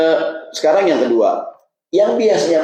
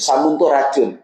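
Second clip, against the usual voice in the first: ordinary talk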